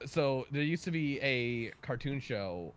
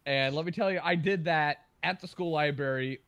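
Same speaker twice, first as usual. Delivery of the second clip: ordinary talk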